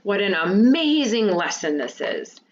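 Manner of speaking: exclaimed, with a swell on AMAZING; strong feeling. Shown as a demonstration. Teacher showing students an exclamation with strong feeling, exclaimed. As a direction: read it as an exclamation, leaning into AMAZING. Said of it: "can also show strong feeling".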